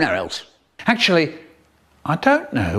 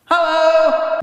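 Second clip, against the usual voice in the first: much higher calling hello; 3 dB louder than usual. Retold or imitated. Mum arriving home calling through the house, high call. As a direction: call hello from the front door. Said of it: "My mum calls out".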